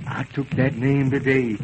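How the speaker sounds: normal talking voice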